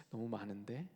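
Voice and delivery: low, hushed remark; understated musing. Acted. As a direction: murmur it low to yourself. 하나님이 쓱 보고 하시는 말씀. (낮게 혼잣말하듯)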